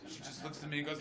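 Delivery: ordinary delivery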